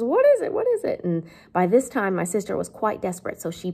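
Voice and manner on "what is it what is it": very high, loud mock nagging; insistent, high-pitched repeated demand; Missus Joe demanding. Teacher voices the sister demanding to know, high and frantic. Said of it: "Missus Joe hates not knowing what's going on".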